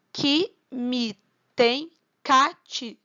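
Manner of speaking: slow, separated syllables for counting; drawn-out, deliberate scansion. Shown as a demonstration. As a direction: scan the verse one syllable at a time. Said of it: "Um, dois, três".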